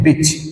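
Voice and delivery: बिच with a short, quick i; short vowel. Shown as a demonstration. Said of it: "ये short sound है".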